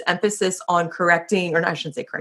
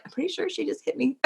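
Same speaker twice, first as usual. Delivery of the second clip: hushed aside; 9 semitones higher, 10 dB quieter than usual. Retold or imitated. Disbelief after being smacked.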